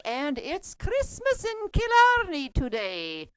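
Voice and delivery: slow, lilting put-on Irish accent; a hammy stab at an Irish accent. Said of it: "I have a terrible Irish accent".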